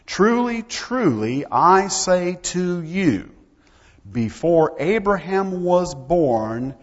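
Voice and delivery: slow, loud, weighty preaching; forceful scripture declamation. Acted. Preacher quoting Jesus confronting the religious leaders, forceful declamation. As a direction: proclaim it, stretching out every key word.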